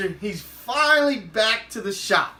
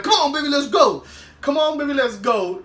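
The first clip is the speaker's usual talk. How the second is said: raised, impatient acted call; loud, high "come along" holler; 7 semitones higher, 4 dB louder than usual. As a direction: call him over loudly to get going. Acts Paperboy calling Bibby to leave.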